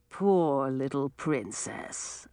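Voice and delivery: mock pity. A narrator's reading. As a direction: taunt her with false sorrow. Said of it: "pretending to look sad".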